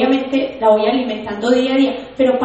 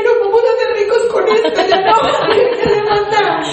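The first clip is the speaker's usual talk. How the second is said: high, laughing cries of joy; laughing excited yell on a motorbike; excited shouting; 8 semitones higher than usual. Retold or imitated. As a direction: yell it laughing like you just struck gold. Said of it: "y gritaba, y lo aruñé".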